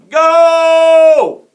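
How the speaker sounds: long, high, very loud holler; shouted title. A narrator's reading. Reader announces the next chapter title, shouting. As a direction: stretch the word into a yell.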